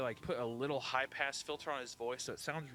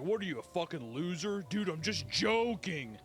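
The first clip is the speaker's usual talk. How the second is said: bully voice; 4 semitones higher, 3 dB louder than usual. Retold or imitated. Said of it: "a bully in school who's just like".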